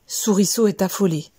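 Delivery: usual speaking voice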